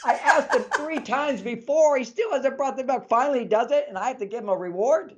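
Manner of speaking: high-pitched mock exasperation; hammed-up wifely outrage, ending on a question; indignant inner voice. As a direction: protest in disbelief as the wife. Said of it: "she's thinking".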